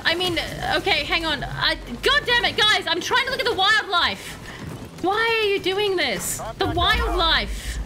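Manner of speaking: ordinary talk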